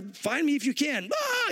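high comic yelp on "Ah"; hammed-up getaway cry; mock panicked escape. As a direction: play-act bolting in terror, pitch leaping up. Imagines himself fleeing the cross.

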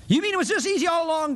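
high, amazed disbelief at how easy it was; astonished question. Retold or imitated. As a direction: pitch way up in retold wonder. Retelling astonished prayer to God.

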